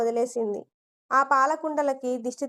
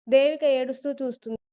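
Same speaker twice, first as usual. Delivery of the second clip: plain reading voice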